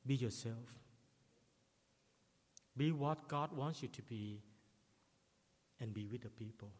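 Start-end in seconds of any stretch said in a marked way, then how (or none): none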